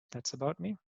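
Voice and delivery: usual speaking voice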